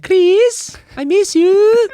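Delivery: high-pitched, hammed-up Latin American accent; mock foreign-accented girlfriend. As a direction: squeal it like a pining girlfriend with a Mexican accent. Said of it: "It's a spot-on accent. I don't know what accent that is".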